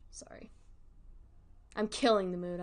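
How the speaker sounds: ordinary talk, nothing marked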